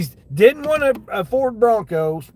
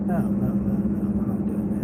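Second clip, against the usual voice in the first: unvoiced, soft whispered refusal; hushed no, no, no under the breath; 5 semitones lower, 3 dB quieter than usual. Real, not acted. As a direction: whisper it to yourself, shaking off the offer. Muttering rejection of a ride offer.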